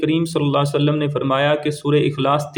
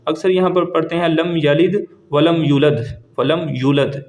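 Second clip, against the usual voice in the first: usual speaking voice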